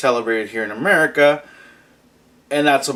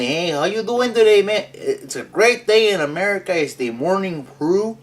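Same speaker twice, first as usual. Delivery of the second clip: playful mock Spanish accent, higher; Spanish-ish accent; 5 semitones higher than usual. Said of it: "in Spanish-ish accent".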